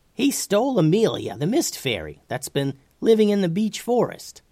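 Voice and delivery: high-pitched, animated storybook character; Tubes reporting urgently. A narrator's reading. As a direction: tell the dragon the bad news.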